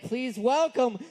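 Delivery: drawn-out, rising emcee announcement; stage host's slow, building welcome; announcer introduction. Real, not acted. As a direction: bring on the act with a big stretched-out name. Host introduces the first act.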